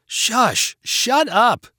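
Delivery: high, sharper yell to silence them; yelled hush. Acted. Lena silencing friends to hear voices, yelling. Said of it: "yelled Lena".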